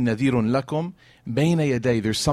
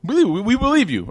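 higher, louder eager reply; eager affirmation; 10 semitones higher, 5 dB louder than usual. Retold or imitated. Meccans assuring the Prophet they believe him, high and eager. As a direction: answer as if it's obvious. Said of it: "And what'd they say?".

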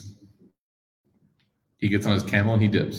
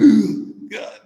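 sharp high gasp of shock; startled gasp; 12 semitones higher than usual. Retold or imitated. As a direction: gasp like your heart just dropped. Companions shocked at Bedouin.

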